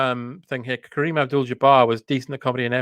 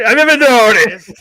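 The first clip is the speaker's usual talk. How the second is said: louder, higher, laughing through it; 7 semitones higher, 9 dB louder than usual. Real, not acted. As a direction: say it while cracking up. Laughing at the joke.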